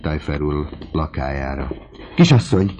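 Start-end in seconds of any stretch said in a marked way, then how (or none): 2.1-2.7 loud, high urgent call; servant's cry. A narrator's reading. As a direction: call out to the young lady in alarm. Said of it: "kiáltotta".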